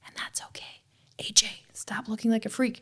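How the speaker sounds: hushed whisper under the breath; whispered aside. Retold or imitated. Mock coach whispering a jab at A J mid-class.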